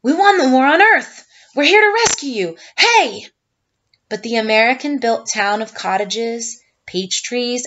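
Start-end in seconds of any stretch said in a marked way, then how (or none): 0.0-3.3 loud, high, excited shouts; shouting. A narrator's reading. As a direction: call out across the valley in triumph.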